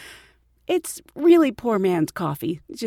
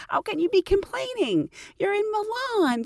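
high, incredulous mock reproach; mock self-scolding; 11 semitones higher than usual. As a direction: tell yourself off in a raised pitch. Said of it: "smack myself, like".

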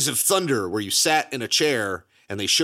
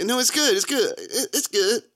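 high, insistent mock fan voice; 11 semitones higher than usual. Mocking prequel fans who insist it's good. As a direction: repeat it like a fan in denial. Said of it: "they like".

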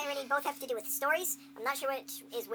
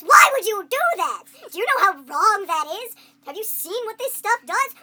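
exasperated hero yelling in disbelief; flipping out; 8 semitones higher, 13 dB louder than usual. Acted. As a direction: flip out at absurd science. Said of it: "main character of a game start flipping out".

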